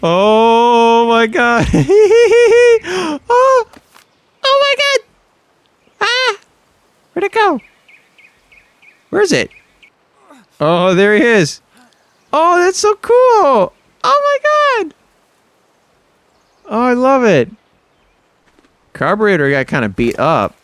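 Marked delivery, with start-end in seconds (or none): 0.0-14.9 thrilled squealing. Real, not acted. Gamer thrilled by what just appeared, squealing with excitement. 16.7-17.5 high, gushing delight. Real, not acted. Gamer loving the new creature, delighted and gushing.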